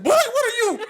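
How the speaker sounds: very high, loud laughing auntie shriek; the aunt startled by her nephew, a high laughing shriek; shocked aunt. Retold or imitated. Aunt's shocked reaction voiced.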